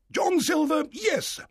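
loud, high squire's outburst; angry. Acted. Squire reacts to the doctor, angry shout. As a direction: burst out indignantly at high pitch. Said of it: "said the squire angrily".

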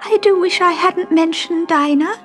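high, tearful regret. Acted. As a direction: near tears with regret. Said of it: "she said tearfully".

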